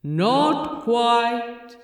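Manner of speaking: a touch of a cool voice from above; lightly voiced disembodied voice. The Metatron answering Aziraphale from above, lightly voiced. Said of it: "said a disembodied voice from above".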